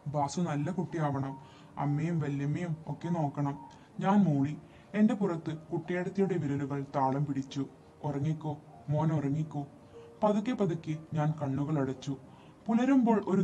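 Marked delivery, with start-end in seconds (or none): 0.1-3.6 soft, soothing bedtime voice; quiet, loving murmur; tender advice. A narrator's reading. Sister soothing boy to sleep. 8.0-9.7 soft, tender lulling; gentle bedtime murmur; soothing to sleep. A narrator's reading. Sister lulling boy to sleep.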